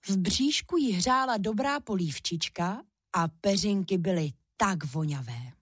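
ordinary delivery

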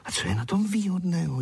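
high, puzzled question; wondering. Acted. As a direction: ask in high-pitched surprise. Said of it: "diví se Zuzka".

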